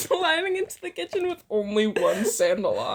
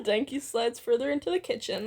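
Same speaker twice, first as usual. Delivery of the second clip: usual speaking voice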